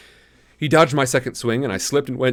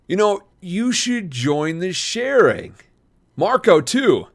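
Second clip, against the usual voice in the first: higher, slow, sly big-brother pitch; sudden idea; 8 semitones higher, 3 dB louder, slower than usual. A narrator's reading. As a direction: dangle the invitation slowly. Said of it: "like he just had an idea".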